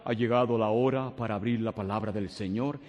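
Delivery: mock solemn preacher. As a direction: parody an overly solemn preacher announcing the Word. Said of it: "Mientras usted hable con más calma".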